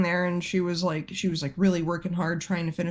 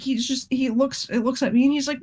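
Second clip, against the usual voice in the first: ordinary delivery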